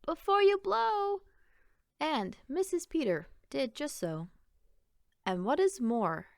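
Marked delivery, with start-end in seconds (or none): 0.0-1.2 much higher and louder, bursting with excitement; children urging their mother on; eager children's cry. A narrator's reading. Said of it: "the children cried".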